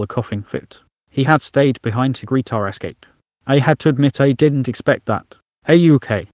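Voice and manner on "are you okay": raised voice over ringing ears; lightly shouted question to a half-deaf friend; shouting. Acted. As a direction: call out loud over the noise. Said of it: "Iklik shouted right next to me".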